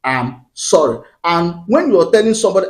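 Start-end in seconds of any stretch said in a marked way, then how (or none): 0.0-1.0 slow, lower-pitched model apology. Shown as a demonstration. Advice-giver modelling the attitude of saying sorry, slow apology. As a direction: say it slowly, each word apart. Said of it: "develop the attitude of saying".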